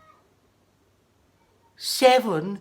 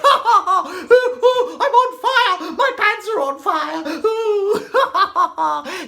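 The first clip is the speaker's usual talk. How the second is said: mock panicked cry; 9 semitones higher, 4 dB louder than usual. Jack's mock cry, laughing.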